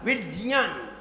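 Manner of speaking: विज्ञान said as "vid-jnyaan"; corrected pronunciation. Shown as a demonstration. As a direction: say विज्ञान with a stop, then j, not g. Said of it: "वो सही है". (विज्ञान को "विज्-ज्यान" जैसा बोलते हुए)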